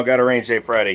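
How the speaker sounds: ordinary delivery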